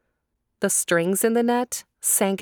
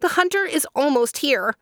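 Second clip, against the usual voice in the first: high, urgent cry; frightened dove; 8 semitones higher than usual. A narrator's reading. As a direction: warn the others in panic. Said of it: "cried a dove".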